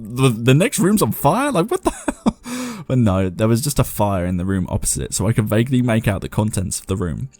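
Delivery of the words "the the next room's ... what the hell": a startled thought that climbs much higher and slower; voicing her own shock while reading, slow and rising; alarmed disbelief. She misreads the story and voices her startled reaction, rising very high. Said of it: "I thought".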